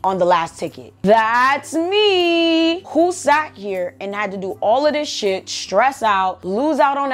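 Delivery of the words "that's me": high, drawn-out singsong; indignant self-credit. Real, not acted. Vlogger claiming credit for the ticket, high indignant singsong. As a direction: stretch each word out high and proud. Answering own rhetorical questions, drawn out.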